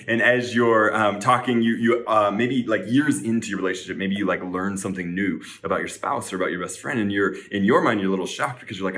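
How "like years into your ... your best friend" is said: ordinary talk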